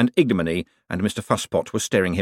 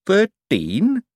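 high, quavering question; dazed, wobbly high voice; 11 semitones higher, 4 dB louder than usual. A narrator's reading. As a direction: quaver it uncertainly. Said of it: "he quavered".

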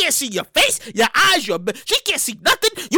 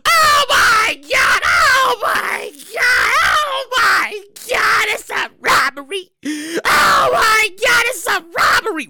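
loud, high drawn-out panicked wail; hammed-up panic with stretched words; panicking teller; 6 semitones higher, 3 dB louder, much slower than usual. Teller handed a note by a masked man, hammed-up panic. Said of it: "If you the teller, right? That's what you thinking".

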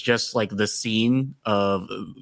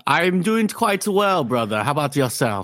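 medieval speech; 5 semitones higher than usual. Acted. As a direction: answer like a courtly knight. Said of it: "a whole bit I was gonna do".

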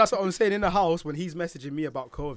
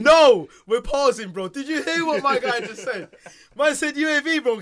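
higher, louder, slowed incredulous laughter; laughing disbelief; 8 semitones higher, 8 dB louder, slower than usual. Real, not acted. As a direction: stop everything, can't believe it. Disbelief at friend's gamer slip.

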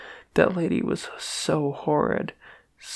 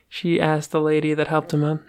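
plain reading voice

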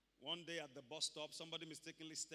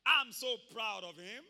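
loud, slow, grand mock quote of God the Father; lightly voiced God the Father, booming; mock misquote; 3 semitones higher, 15 dB louder, slower than usual. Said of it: "did God the Father say".

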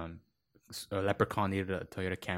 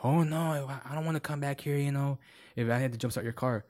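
higher-pitched mock complaint; complaining customer; 6 semitones higher than usual. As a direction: imitate a whining customer. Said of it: "the customer was complaining".

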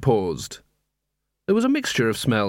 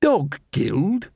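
sarcastic snarl; 6 semitones higher than usual. Acted. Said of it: "snarled Angua sarcastically".

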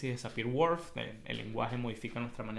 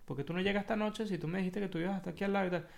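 higher, fast nagging wife; suspicious wife; 6 semitones higher, faster than usual. Retold or imitated. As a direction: grill him like a jealous wife.

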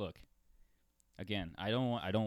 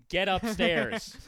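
slow, much higher and louder barked order; mom yelling; 11 semitones higher, 11 dB louder, much slower than usual. Retold or imitated. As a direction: yell it like a mother sending kids upstairs. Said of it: "She's like".

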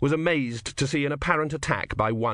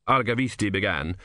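ordinary delivery

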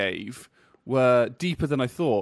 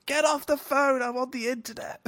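high, mock yelling husband; shouting at wife; 13 semitones higher than usual. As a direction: play the annoyed husband yelling. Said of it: "suddenly shout to your wife".

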